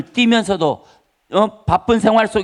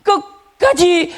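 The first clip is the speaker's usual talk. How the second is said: 끝까지 very high and drawn out; emphatic stretched stress; 11 semitones higher, 3 dB louder, much slower than usual. Real, not acted. Preacher insisting the self must collapse, 끝까지 high and long. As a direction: stretch 끝까지 hard, pitch leaping up.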